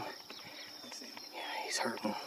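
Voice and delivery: wildlife watching whisper. Real, not acted. Watching the wounded coyote.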